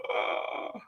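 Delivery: soft, voiceless straining noise; strained stuck groan. Retold or imitated. Trying to get out of bed, strained breathy groan. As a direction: strain to move and fail.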